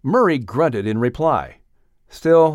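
ordinary delivery, nothing marked

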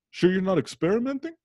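high, probing Brooklyn dad voice; Brooklyn detective dad. Retold or imitated. Brooklyn dad probes whether son is experimenting, high and doubtful. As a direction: imitate a Brooklyn father fishing for another answer. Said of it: "he talks like a detective from Brooklyn".

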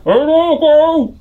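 put-on high panicked boy voice, shouting; muffled panicked kid. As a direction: yelp it out from under cover, high and scared. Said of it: "you just hear his muffled voice go".

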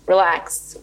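a hint of the coach's New York accent; a calm, blunt Relax from a coach. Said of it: "she's like".

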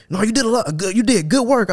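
high-pitched, earnest manager impression; imitated manager. Plant manager explains his praise to worker, high imitated voice.

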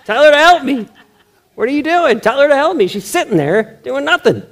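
high, loud, exasperated demand; loud whiny complaint to the Lord; exclaiming, as written. Acted. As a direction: read it with the exclamation point, pleading and fed up. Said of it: "I'm just gonna read it like it says".

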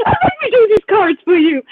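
high, loud mock-eager offer; hammed-up excited pitch. Acts herself offering cards.

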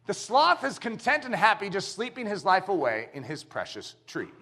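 ordinary talk

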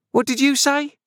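very high, shrieked demand; beginning to scream. A narrator's reading. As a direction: shriek it in outrage, fairly quick. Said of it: "beginning to scream".

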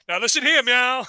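hammed-up officer's command; mock-stern cop. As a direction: order it like a cop slipping in a meow. Acting out cop playing cat game.

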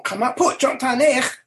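a touch of Klingon fury; angry Klingon line. Acted. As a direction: quote the Klingon commander's outburst. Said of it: "Krug is angry".

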